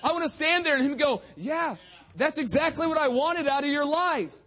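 loud, high-pitched pulpit exhortation; rally the congregation loudly; forceful preaching. Real, not acted.

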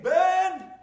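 a woman yelling up to the dorm with news; calling out. Retold or imitated. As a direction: shout his name from a distance. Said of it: "heard her voice calling my name".

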